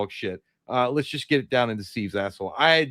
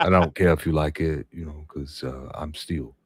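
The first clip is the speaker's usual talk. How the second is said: imitating Shaq; 7 semitones lower than usual. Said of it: "I can't do Shaq".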